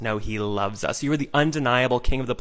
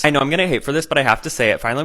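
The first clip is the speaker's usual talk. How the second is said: ordinary talk, nothing marked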